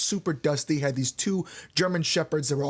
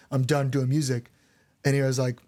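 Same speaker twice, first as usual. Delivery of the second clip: ordinary delivery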